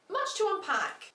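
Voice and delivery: usual speaking voice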